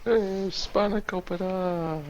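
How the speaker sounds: slow, high, stretched hey; lightly voiced fisherman calling a name; drawn-out greeting. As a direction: drawl out a goofy hello.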